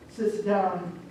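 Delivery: ordinary delivery, nothing marked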